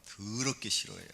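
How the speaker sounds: ordinary talk, nothing marked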